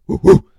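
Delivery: loud woofs; sudden, loud mock barking; imitated dog bark. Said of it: "I was being a dog".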